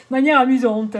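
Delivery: wry, amused repeat of the phrase; amused echo. Real, not acted. Oracle reader joking about the card's promise, amused echo. Echoes card wryly after laughing.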